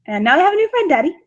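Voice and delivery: put-on high, sing-song daddy's-girl voice; butter him up with a high sweet lilt; sweet girly. Daughter to her dad, sugary and high.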